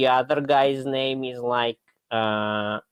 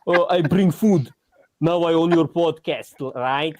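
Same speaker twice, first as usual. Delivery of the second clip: imitating the DoorDash driver in a put-on accent, higher and louder; mock fake accent; 5 semitones higher, 4 dB louder than usual. DoorDash driver taking over the podcast, hammed-up fake accent. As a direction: ham up a cocky delivery guy's fake accent. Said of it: "he has a fake accent".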